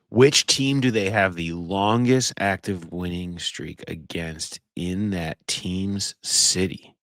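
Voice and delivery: drawn-out thinking aloud; stumped, stretching out each word; slow pondering. Real, not acted. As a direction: repeat the question slowly, mulling it over. Stumped, repeating trivia question to himself.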